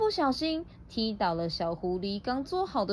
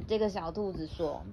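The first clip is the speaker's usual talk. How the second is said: usual speaking voice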